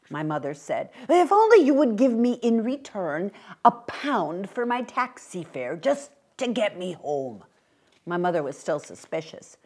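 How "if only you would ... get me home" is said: mildly urgent, starting louder and easing off; a hint of hopeful old gentleman; lightly voiced pleading old man. Old man asks for taxi fare, politely pleading.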